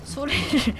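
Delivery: ordinary delivery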